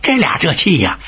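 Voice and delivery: plain reading voice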